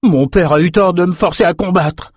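high-pitched, emphatic complaint; grumbling. A narrator's reading. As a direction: grumble indignantly like a resentful young noble. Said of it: "grogna Brock".

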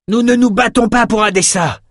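high-pitched battle cry; hurled retort. Acted. Gladiator to his adversary in combat, hurled cry. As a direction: throw it at the enemy as a defiant cry. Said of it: "lança un de ses adversaires".

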